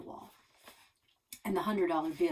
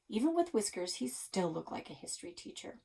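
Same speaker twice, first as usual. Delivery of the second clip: plain reading voice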